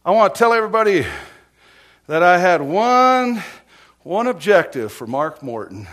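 imitated sergeant addressing the whole room; loud toast. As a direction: boom it out as a mock public announcement.

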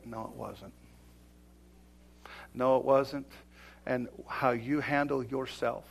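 ordinary talk, nothing marked

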